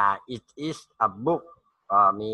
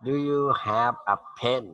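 slow model question; much slower than usual. Shown as a demonstration. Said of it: "teacher models question slowly".

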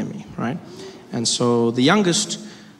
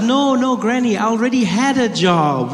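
protesting grandson; 9 semitones higher, 4 dB louder than usual. Retold or imitated.

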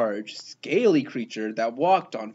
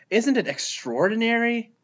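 high-pitched wonder, storybook style; amazed delight; 8 semitones higher than usual. A narrator's reading. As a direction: marvel at a little treasure. Frog marvels at her pebble.

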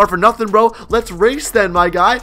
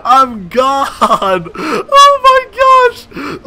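high, slow helpless laughter breaking through the words; laughing hard; 9 semitones higher, 4 dB louder, much slower than usual. Real, not acted. Gamer cracking up after leaving the girl behind, laughing.

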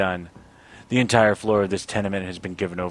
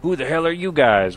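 louder, higher annoyed cop demanding who they are; gruff cop challenge; 8 semitones higher, 6 dB louder than usual. Acted. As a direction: bark it at the intruders. Voicing hostile cop.

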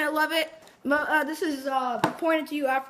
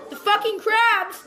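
louder, higher, slow outraged complaint; indignant rant; 5 semitones higher, 5 dB louder than usual. Real, not acted. Ranting gamer loudly complains about smiling crabs. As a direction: spell out the outrage word by word.

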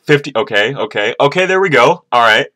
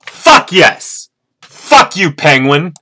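loud, high gamer's victory yell; triumphant yelling; 5 semitones higher, 8 dB louder, much slower than usual. Real, not acted. Gamer finally finding the penguin, triumphant yell.

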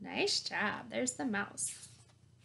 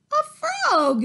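much higher, louder announce; excited exclaim; 10 dB louder than usual. A narrator's reading. Mama showing Mouse a frog, excited. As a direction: burst out with it. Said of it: "said Mama".

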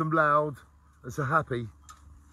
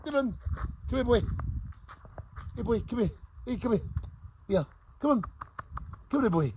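calling a dog; 6 semitones higher, faster than usual. Real, not acted. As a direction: call the dog in from across the yard.

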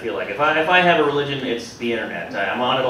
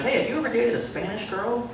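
lightly voiced stranger, higher and softer; nosy stranger; 8 semitones higher, 5 dB quieter than usual. Guy at a party questions the comic out of the blue, nosy. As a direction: imitate someone prying out of the blue.

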